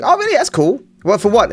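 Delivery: high, friendly tailor voice; imitating the tailor. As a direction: lightly mimic the chatty tailor. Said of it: "And I'm like".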